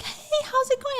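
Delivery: very high, sing-song fake-friendly hello; greeting from the liar, high and breezy; mock cheery greeting. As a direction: chirp it like a clueless greeter. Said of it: "he's like".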